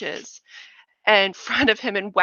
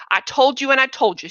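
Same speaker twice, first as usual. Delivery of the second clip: scolding aunt; 5 semitones higher, 8 dB louder than usual. A narrator's reading. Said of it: "she said it in what was in Lenny's voice".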